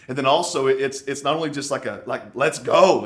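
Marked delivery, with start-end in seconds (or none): 2.4-3.1 loud, punchy rallying shout; excited rallying cry. Acted. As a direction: fire them up with a louder call. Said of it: "He gets a little excited here".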